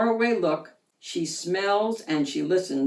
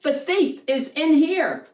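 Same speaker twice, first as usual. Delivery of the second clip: eager bark; 7 semitones higher, 4 dB louder than usual. Acted. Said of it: "the bark meant".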